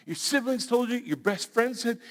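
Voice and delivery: usual speaking voice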